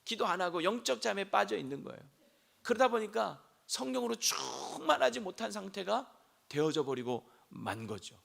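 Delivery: normal talking voice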